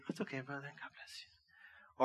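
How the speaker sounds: soft, breathy whispered blessing; gracious whisper. Retold or imitated. Forgiving a brother who bumps into him, in a soft whisper. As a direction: whisper it sweetly, like a saint. Gracious reply when bumped.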